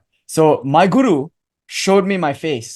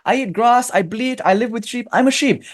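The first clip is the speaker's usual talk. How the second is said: insistent little lion; 9 semitones higher than usual. Acted. Student lion insisting to the guru lion, high and stubborn. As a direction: plead your case in a much higher voice. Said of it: "He said".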